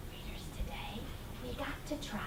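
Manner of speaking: hushed teacher whisper. Real, not acted. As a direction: whisper it to the class. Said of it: "whispered opening".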